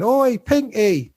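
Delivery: high, slow Cockney shout of "Oi, Pinky!"; Cockney kid's call. Retold or imitated. Friends calling the boy across the tracks, high Cockney call. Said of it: "his friends going".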